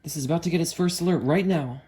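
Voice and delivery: real excitement rising to a loud yes; excited. Real, not acted. Alert about to sound on radio.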